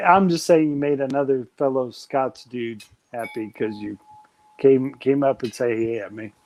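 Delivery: normal talking voice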